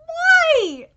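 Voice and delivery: high loud "Why?"; anguished cry. Real, not acted. Fan begging the studio with a loud high cry. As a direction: cry out to the heavens. Exasperated complaint.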